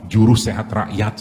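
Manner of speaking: slow, deliberate punchline answer; slow wry retort to a patient's question; deadpan punchline. Retold or imitated. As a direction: land the joke slowly and dryly. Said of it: "saya bilang".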